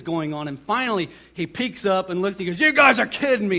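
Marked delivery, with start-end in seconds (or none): 2.6-3.6 loud, high mock yell; outraged disbelief. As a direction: imitate a prank victim's indignant outburst. Prank victim finds out, retold.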